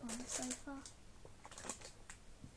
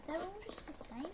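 ordinary talk

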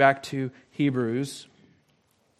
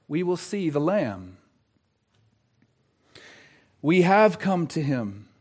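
normal talking voice

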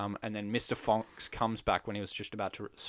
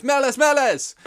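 a high, loud yelled Latin name; hammed-up cry of Badger's Latin name; mock yell; 14 dB louder than usual. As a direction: shout it out like a crazed naturalist. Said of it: "yells their Latin name".

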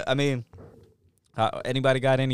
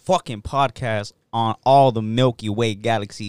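loud, drawn-out show intro, easing off at the end; hype podcast announcer; 3 semitones higher, 9 dB louder, slower than usual. Acted. Host opens the show for listeners, big announcer hype. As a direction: belt out the welcome like a hype man.

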